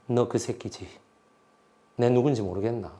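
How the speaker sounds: woman threatening her bound captive, low and menacing; low menacing threat. Retold or imitated. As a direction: threaten quietly at gunpoint.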